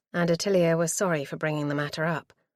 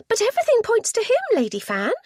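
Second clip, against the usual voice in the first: excited; 6 dB louder than usual. A narrator's reading. Niece pressing eagerly.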